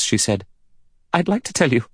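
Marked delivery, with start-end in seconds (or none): 1.1-1.8 high trembling voice; nervous, shaky confession. A narrator's reading. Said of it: "spoke in a trembling voice".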